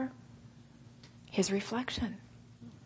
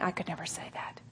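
whisper; 4 semitones lower, 13 dB quieter than usual. Retold or imitated. Quoting doubtful listeners.

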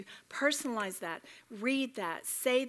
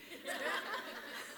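short laugh; 6 semitones higher, 9 dB quieter than usual. Real, not acted. Laughs at her own singing.

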